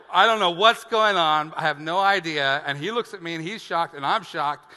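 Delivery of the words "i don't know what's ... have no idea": flustered. Retold or imitated. Panicked explanation to the man.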